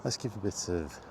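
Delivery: ordinary talk, nothing marked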